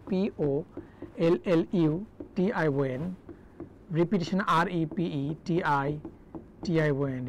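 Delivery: slow letter-by-letter dictation; spelling out. Shown as a demonstration. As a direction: give each letter its own beat, slowly. Teacher spells words letter by letter. (ধীরে একটা একটা করে বানান বলা)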